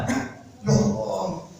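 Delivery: long, hushed, marvelling exclamation; astonished. Acted. Halimah marvels at her sudden miracle of milk, astonished.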